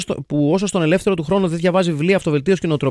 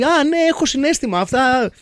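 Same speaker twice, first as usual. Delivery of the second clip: higher-pitched mock actor feigning emotion; προσποιητό συναίσθημα; 8 semitones higher than usual. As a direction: lightly mimic an actor trying to show feeling. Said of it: "προσπαθεί στις σκηνές ... να δείξει ότι". (κοροϊδευτική μίμηση ηθοποιού με δήθεν συναίσθημα, πιο ψηλά)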